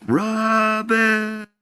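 high, drawn-out call from across a pasture; distant call. Retold or imitated. Mysterious voice calling a boy across the pasture, high and drawn out. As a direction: call the name like a far-off voice. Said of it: "I heard this sound".

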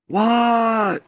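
high hollered answer; hollering back. Retold or imitated. Boy calling back across the pasture, high holler. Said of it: "I hollered across the pasture back".

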